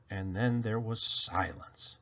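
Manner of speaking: usual speaking voice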